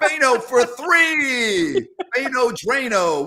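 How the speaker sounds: high, loud, drawn-out play call; excited announcer call. Acted. Host calling out a fan favorite's shot, excited announcer call. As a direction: stretch it out like a three-pointer just dropped. Calling out player's old catchphrases.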